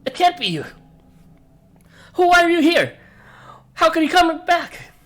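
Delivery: disbelief rising into high, loud cries; shock and terror. Acted. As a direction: build from stunned denial to shouting. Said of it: "You see shock and terror reflected".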